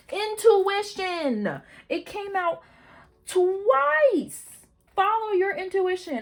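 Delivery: high, loud, stretched-out exclaim; thrilled, shout the news; excited exclaim. Real, not acted. Reader excitedly telling viewers the card came twice, high and loud.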